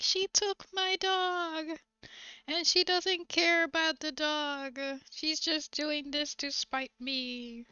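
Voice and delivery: drawn-out, high-pitched mock complaint; whiny court litigant. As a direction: complain like an aggrieved litigant, dragging the words. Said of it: "Are they like".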